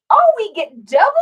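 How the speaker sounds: high, loud, delighted to the dogs. Real, not acted. Both dogs arrive together, loud excited baby talk. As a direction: squeal with delight at the pups.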